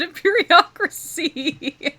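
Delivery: high, loud, drawn-out laughter on the words; real laughing. Real, not acted. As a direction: crack up helplessly on the punchline. Cracks up at own joke line.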